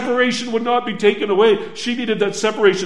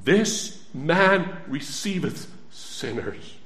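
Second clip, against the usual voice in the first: slow solemn reading; 4 semitones lower, 5 dB quieter, slower than usual. Shown as a demonstration. Preacher reading the verse slowly to the congregation. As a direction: read it slowly, each word weighed. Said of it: "What do we read in the Bible?".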